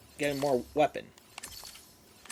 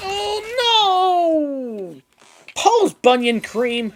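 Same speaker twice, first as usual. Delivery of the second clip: hammed-up dismay; 10 semitones higher, 7 dB louder, much slower than usual. Mock dismay at loss.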